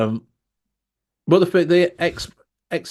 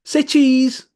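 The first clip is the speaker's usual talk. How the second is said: shrill-free bright call, much higher and louder; high loud photographer call; 11 semitones higher, 5 dB louder than usual. Retold or imitated. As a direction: sing out say cheese like a cheerful snapper. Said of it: "he goes".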